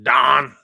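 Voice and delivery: "Don" for "down", drawn as D-A-H-N; "Don" in Pittsburgh style, no w glide; Yinzer vowel. Shown as a demonstration. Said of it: "D A H N".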